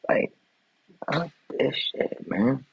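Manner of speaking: muttered in disgust; fed-up grumble. Real, not acted. Fed-up muttering after outburst.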